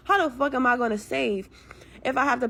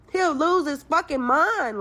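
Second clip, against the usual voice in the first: higher, louder and slower, leaning on he'll; emphatic repeat; 6 semitones higher, 4 dB louder, slower than usual. Real, not acted. Caller complaining about the ex, emphatic exasperated repeat. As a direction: hammer the point home.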